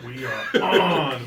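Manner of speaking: slow announcement. Acted. Drawn-out on-air announcement.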